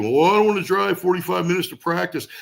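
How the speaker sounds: lightly mocking whine; whiny. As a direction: imitate a lazy bandmate's whine. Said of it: "Whiny ass".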